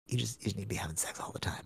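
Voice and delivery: fast, hushed whisper; quick whispered aside. Retold or imitated. Quoting girlfriend's joking remark.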